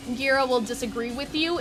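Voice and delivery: ordinary talk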